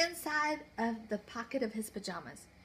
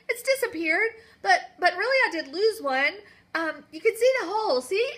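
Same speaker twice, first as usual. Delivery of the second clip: put-on tearful boy; 8 semitones higher, 10 dB louder than usual. Walter pleads, close to tears.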